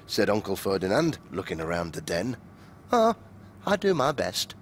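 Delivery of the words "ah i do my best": sly. A narrator's reading. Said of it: "said Fox with a sly grin".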